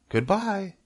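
very high little farewell; tiny spiders calling. A narrator's reading. Baby spiders leaving Wilbur, calling out high. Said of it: "they called".

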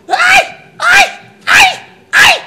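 loud, very high wails of pain; repeated howls of agony; agonized screams. Acted. Screaming from poisoned belly.

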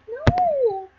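very high, long-drawn no; mock dismay. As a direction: wail it in protest at the cruel order. Reader reacts to baby's fate.

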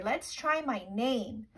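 ordinary talk

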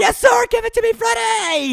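high-pitched call to a teammate; excited call-out. Real, not acted. Player calls Freddy for the ball, excited high call. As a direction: call eagerly for the pass, voice shooting up.

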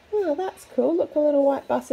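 usual speaking voice

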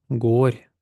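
"Горь" with the "о" held long; long vowel. Shown as a demonstration. Teacher repeats after naming length, "Горь" with long "о". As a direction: draw out "о" in "Горь". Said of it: "Довга".

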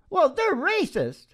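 normal talking voice

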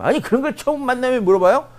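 high, loud, offended retort; mock indignation. Man taken aback by a salary question, high mock-angry protest. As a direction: act the affronted date. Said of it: "남자들이 막 화를 내고".

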